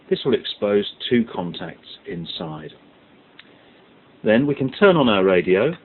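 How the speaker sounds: ordinary delivery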